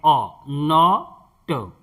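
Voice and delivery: नॉट said slowly with a long open o sound; drawn-out not. Shown as a demonstration. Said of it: "ओ पढ़ते हैं".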